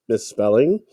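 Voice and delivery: normal talking voice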